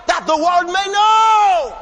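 loud, slow preaching climax; slow loud crest of the sermon line; rising shout. Real, not acted.

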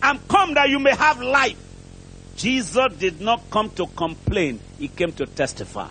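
ordinary talk, nothing marked

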